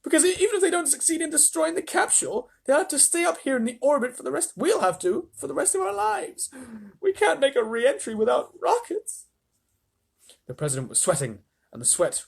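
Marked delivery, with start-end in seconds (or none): 0.0-9.2 shouting astronaut. A narrator's reading. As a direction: yell the report. Shanks panicked.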